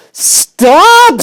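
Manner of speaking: very high, loud shriek; screamed. Acted. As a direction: scream it to cut her off. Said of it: "screamed Wilbur".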